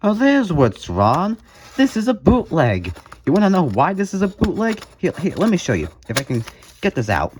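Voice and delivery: ordinary talk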